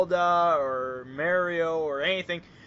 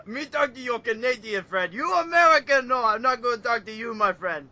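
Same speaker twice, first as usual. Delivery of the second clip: higher mock Japanese accent, broken English; Akira Toriyama; 4 semitones higher, 4 dB louder than usual. Artist favours Canadian over American, mock Japanese accent. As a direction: welcome one, loudly refuse the other, Japanese accent. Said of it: "you hear Kurt Toriyama".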